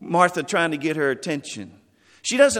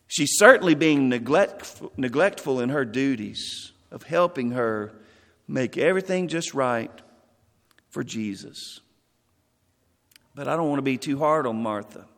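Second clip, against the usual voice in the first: ordinary talk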